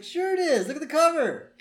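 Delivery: put-on squeaky bratty boy; Bart voice. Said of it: "Bart's like".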